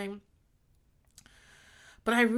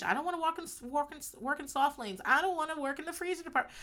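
whiny coworkers; 8 semitones higher, 4 dB quieter, faster than usual. Retold or imitated. Coworkers whining about sections.

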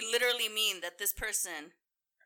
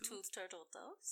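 Russian accent; 15 dB quieter than usual. Shown as a demonstration. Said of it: "you did a terrible job".

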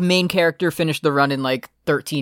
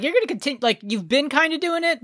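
much higher, lightly voiced teammate; mock questioning voice; 11 semitones higher than usual. Host voices the teammates asking him, in a much higher put-on voice. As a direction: ask it in a high put-on voice.

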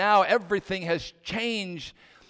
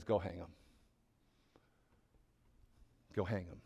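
much lower and softer, a grim hushed command; king quietly ordering the hanging; cold quiet order; 11 semitones lower, 14 dB quieter than usual. Acted. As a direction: deliver the death sentence in a low hush. King orders Haman's execution.

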